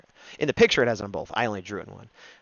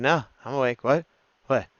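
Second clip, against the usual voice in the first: pretending to be awake; 3 semitones higher, 6 dB louder than usual. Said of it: "this is my impression of her".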